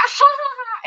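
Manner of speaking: high, mock crazed laugh; psychopath laugh. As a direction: fake a mirthless psycho cackle. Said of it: "that psychopath where you kinda laugh".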